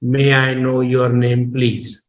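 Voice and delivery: "May I know your name, please" like a talking machine; level tone all through, no lift on "please"; machine-like monotone. Shown as a demonstration. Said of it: "It looks like a machine speaking to you".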